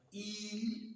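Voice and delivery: "eel" with one long, steady ee; long vowel. Shown as a demonstration. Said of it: "with a long vowel".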